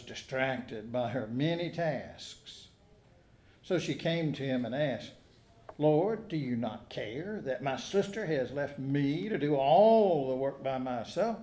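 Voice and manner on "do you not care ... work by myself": ordinary talk, nothing marked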